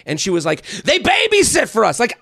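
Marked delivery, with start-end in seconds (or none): 0.8-2.0 indignant wife voice. Retold or imitated. Wife voiced in retold argument.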